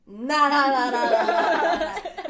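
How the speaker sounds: loud, high repeated nah; loud refusal. Retold or imitated. Refusing mom's blood on her face, loud. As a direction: refuse it loudly, half laughing.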